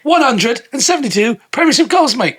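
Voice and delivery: high-pitched boastful English bloke; hammed-up footballer impression; Carl Fletcher. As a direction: brag in a put-on English accent. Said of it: "now doing Carl Fletcher".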